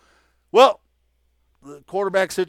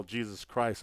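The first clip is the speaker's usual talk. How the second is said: much softer, lower exasperated mutter; under his breath; 6 semitones lower, 10 dB quieter than usual. Retold or imitated. Coach goaded by a reporter, a soft retold mutter. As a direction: curse quietly to yourself. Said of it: "underneath his breath".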